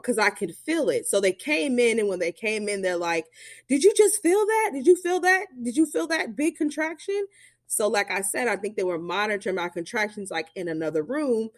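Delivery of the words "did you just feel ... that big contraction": much higher, eager questioning voice; urgently checking she felt it; excited staff. Retold or imitated. Staff asking about contraction.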